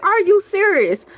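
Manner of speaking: high, loud disbelief; mock disbelief. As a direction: gasp out the question in surprise. Shocked reaction.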